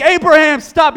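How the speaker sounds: high, loud call of stop; urgent shout. Acted. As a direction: cry out to halt the knife. Angel's call retold dramatically.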